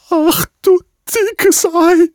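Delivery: very high, squeaking goose voice; squeaky duck voice. Acted. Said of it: "quietschte Jemima".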